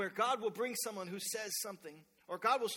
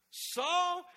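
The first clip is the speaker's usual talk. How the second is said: voice from heaven; 8 semitones higher, 7 dB louder than usual. Acted. Voice from heaven calls.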